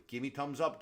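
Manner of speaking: ordinary talk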